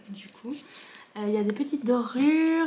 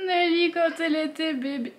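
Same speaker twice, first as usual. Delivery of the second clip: higher, swooning repeat of the words; gushing; 6 semitones higher than usual. Real, not acted. Fan gushes to viewers over baby photo of Nelly.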